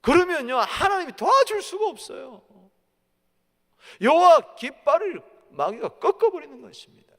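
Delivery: high, loud pulpit warning; full-force preaching. Real, not acted. Preacher warns God cannot help then, full-force preaching. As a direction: hammer the warning home at full voice.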